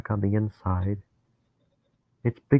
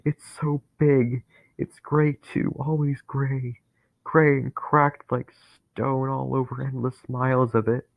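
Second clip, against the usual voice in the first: terrified awe; 6 semitones higher than usual. Acted. Dennis describing the vast center, awed and afraid. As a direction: gasp at its size.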